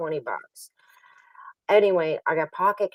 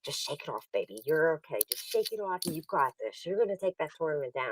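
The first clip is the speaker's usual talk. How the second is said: quiet coaxing pep talk; retold reassurance; 9 dB quieter than usual. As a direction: gently imitate a dealer consoling a player. Dealer soothing her after a loss.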